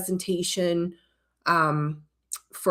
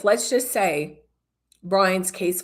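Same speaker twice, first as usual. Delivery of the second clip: ordinary delivery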